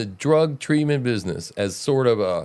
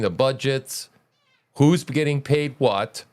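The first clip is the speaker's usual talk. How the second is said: normal talking voice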